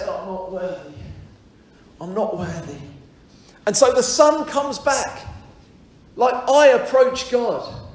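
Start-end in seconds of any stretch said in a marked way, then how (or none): 0.0-2.8 humble plea. Retold or imitated. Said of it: "I'm gonna say".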